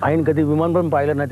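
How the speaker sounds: ordinary delivery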